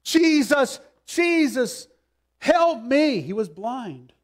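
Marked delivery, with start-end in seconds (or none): 0.0-3.2 high, loud desperate cry; crying out for help. Retold or imitated. Blind Bartimaeus crying out to Jesus, loud desperate plea. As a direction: call out to him like a blind beggar begging. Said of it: "was crying out".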